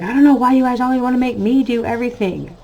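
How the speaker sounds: imitating a fast, whiny preteen complaint; whiny son. Mom mimicking her son's whiny complaint about chores. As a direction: rattle it off like an annoyed kid. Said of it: "He's like".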